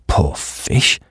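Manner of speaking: usual speaking voice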